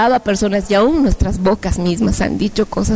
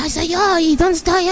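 bewildered; 8 semitones higher than usual. Retold or imitated. Hearing own voice, bewildered flying.

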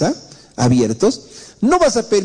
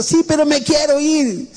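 much higher, whiny "sí"; joven terco; 11 semitones higher than usual. Retold or imitated. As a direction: stretch "sí" like a sulking kid. ("sí" muy agudo y quejoso del joven)

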